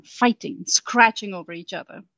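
ordinary delivery

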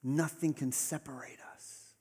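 soft unvoiced whisper; whispered promise. Jesus promising the congregation, whispered close. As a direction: let it fall to a whisper.

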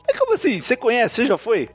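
mock shock at his wife's answer; retold disbelief. Retold argument with his wife. (incrédulo, bem mais agudo)

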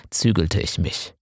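ordinary delivery, nothing marked

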